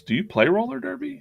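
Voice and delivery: squeaky high-pitched question, "Do you play roller derby?"; high girl voice. Retold or imitated. Receptionist asking his ex-wife in a squeaky high voice. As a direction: pitch it way up like a bright young clerk.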